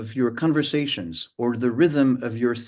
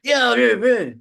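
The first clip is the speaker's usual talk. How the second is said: high, loud impatient yeah yeah; mock impatient cutting in; adversarial voice; 9 semitones higher, 6 dB louder than usual. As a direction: brush the other person off. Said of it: "another way in which it sometimes happens".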